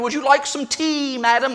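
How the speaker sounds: badly faked British accent, politely serving. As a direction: offer it like a hammy British butler. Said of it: "worst British accent you've ever heard".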